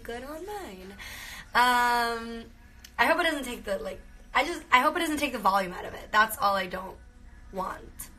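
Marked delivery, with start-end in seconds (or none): none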